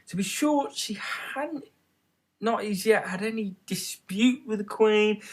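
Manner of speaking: a hint of a nervous child; lightly voiced worried girl. A narrator's reading. Alice uneasy, voiced thought.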